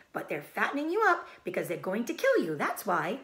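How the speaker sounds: fast, loud blunt sheep; old sheep voice. Acted. Old sheep telling Wilbur he will be killed, fast. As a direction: blurt it out bluntly. Sheep tells pig of slaughter.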